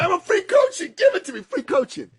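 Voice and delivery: high, loud, excited yelp; over-the-top retold eagerness; eager outburst. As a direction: jump at the offer, voice shooting up. Retold eager grab at free coaching.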